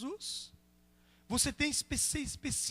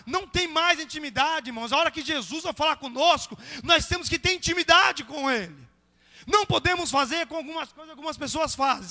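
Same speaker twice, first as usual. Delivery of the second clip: loud, high, fired-up sermon delivery; fervent, shouted pulpit exhortation; full-force preaching; 6 semitones higher, 10 dB louder than usual. Real, not acted. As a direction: hammer it out to the congregation.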